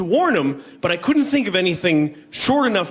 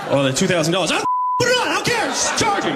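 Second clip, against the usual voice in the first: high airy voice, waving money away; breezy, don't-care rich guy; mock careless spender; 11 semitones higher than usual.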